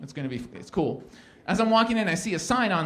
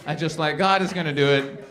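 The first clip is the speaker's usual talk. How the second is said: ordinary talk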